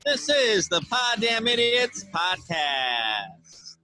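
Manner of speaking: rising announcer roll call; booming sing song welcome. Acted. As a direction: call it like a show host.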